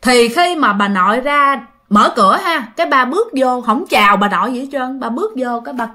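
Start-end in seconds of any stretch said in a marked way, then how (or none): none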